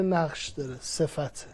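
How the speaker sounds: usual speaking voice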